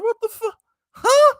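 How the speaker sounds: very high-pitched incredulous squawk; baffled disbelief. Retold or imitated. Reacting to family being called country, high-pitched disbelief.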